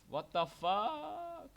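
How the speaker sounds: stretched-out high disbelieving cry; real slow high disbelief; drawn-out shocked what. Real, not acted. Fell to his death in game.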